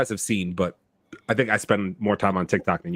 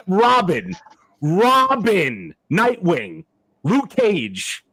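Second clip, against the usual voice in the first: high, disbelieving cry of outrage; can't believe this ranking, voice climbing; exasperated disbelief at a silly fan ranking; incredulous outburst; 9 semitones higher, 4 dB louder, much slower than usual. Real, not acted. Outraged at ridiculous TikTok ranking.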